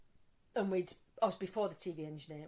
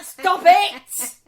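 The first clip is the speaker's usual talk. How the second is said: high, loud mock outrage; scandalised shriek of protest; retold protest; 11 semitones higher, 19 dB louder than usual. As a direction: shout it down playfully. Reacting to husband's rude teasing.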